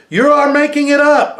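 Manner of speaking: put-on very high, loud child's cry; little brother yelling he is making it up; angry accusing shout. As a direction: throw the accusation back at him. Brothers quarrel, accusing again.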